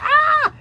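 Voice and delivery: high, loud mock shriek; hammed-up wail of a hurt animal; wounded-antelope scream. Teacher being arrested, mock wounded scream. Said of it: "sounds like a fucking wounded antelope".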